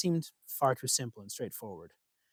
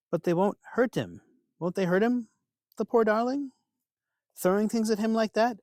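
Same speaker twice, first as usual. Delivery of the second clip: put-on fretful kindly old woman; 8 semitones higher than usual. Disguised Loki feigns worry for Balder.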